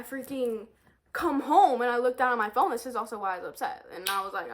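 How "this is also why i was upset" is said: normal talking voice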